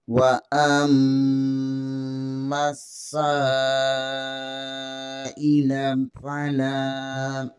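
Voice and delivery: long, drawn-out Quranic chant; slow recitation. Shown as a demonstration. Teacher models the verse for students, slow chant. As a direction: stretch every vowel as in tilawat.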